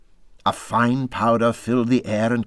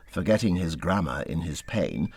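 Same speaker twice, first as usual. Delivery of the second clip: ordinary delivery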